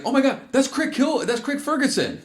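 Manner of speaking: mock fangirl squeal; excited mom. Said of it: "she was like".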